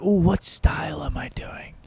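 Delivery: hushed, whispery mock question; mock comedians' talk. Comedian imitating comics discussing style, mock whisper.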